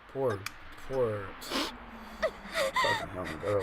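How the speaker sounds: ordinary talk, nothing marked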